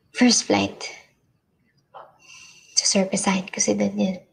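whispered to herself; whispering. Acted. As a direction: hush it like a secret plan. Private plan said to herself.

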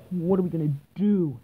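normal talking voice

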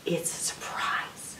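hushed, drawn-out whisper; secretive whisper. A narrator's reading. Mother rabbit teases a surprise to her child, secretive whisper.

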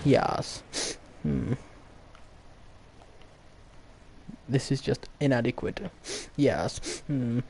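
mock-posh English, understated and pompous; Queen's proper English. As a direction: hum and yes like an English toff. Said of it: "talking in the Queen's proper English".

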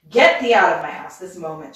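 loud, furious witch's order; narrated shriek. A narrator's reading. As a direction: raise the voice and throw her out. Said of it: "she shrieked".

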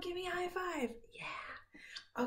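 whispered high five. Real, not acted. Teacher asking child for high five, whispered. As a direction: whisper it close and playful.

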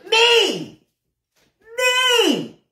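high, drawn-out exclamation of disbelief; mock outrage. Real, not acted. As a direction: cry "me" high, as if singled out. Mock outrage at being singled out.

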